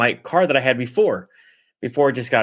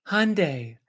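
softer imitation of a pronunciation voice; soft demo-voice HUN-day; search voice pronouncing; 3 semitones higher, 5 dB quieter than usual. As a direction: say Hyundai like an online pronunciation guide. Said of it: "the voice goes like".

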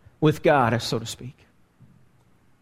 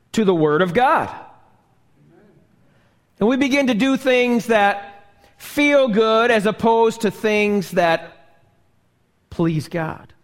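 usual speaking voice

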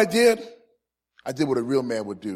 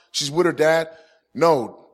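ordinary talk

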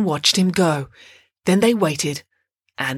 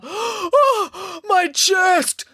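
high, gasping cry of pain; 11 semitones higher, much slower than usual. A narrator's reading. Giant collapsing in the chase, high gasping cry. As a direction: cry out breathless, clutching for air. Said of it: "cried the giant".

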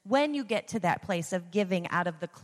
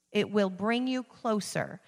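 usual speaking voice

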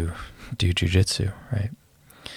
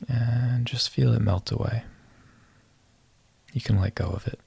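guided meditation. Acted. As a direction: ease them into relaxing.

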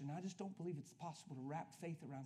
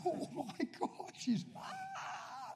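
shocked; 13 semitones higher, much slower than usual. Retold or imitated. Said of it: "That was like".

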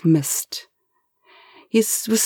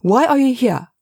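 sharp, angry question; angrier than meant; 7 semitones higher, 3 dB louder than usual. A narrator's reading. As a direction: snap the question out with more anger than intended. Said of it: "he sounded much angrier than he meant".

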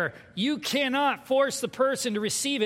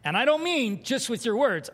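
ordinary talk, nothing marked

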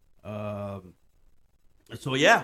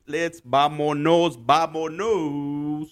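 drawn-out hype call; 2 semitones higher, 7 dB louder, much slower than usual. Pretend.

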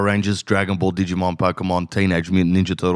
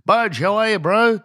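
much higher, chirpy imitation of the brother-in-law; 12 semitones higher than usual. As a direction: greet him like an over-keen bro on the phone. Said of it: "phone call from my brother-in-law".

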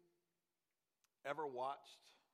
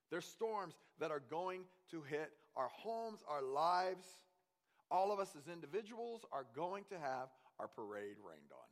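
ordinary talk, nothing marked

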